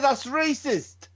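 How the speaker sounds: high, drawn-out mock outrage; son's slang voice. Dad mimicking his son's comeback, mock affronted. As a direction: imitate an affronted slang-talking lad. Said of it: "He said".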